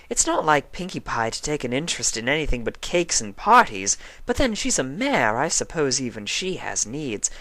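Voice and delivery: dramatic, refined suspicion; Rarity musing. A narrator's reading. Rarity alone in her room, musing grandly.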